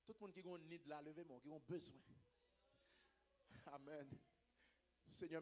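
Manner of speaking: quiet, measured pastoral prayer; hushed prayer. Real, not acted. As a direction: speak softly and reverently to God. Leads congregation into prayer.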